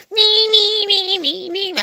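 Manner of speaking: high squeaky nee-nee noise; crawling noise. Retold or imitated.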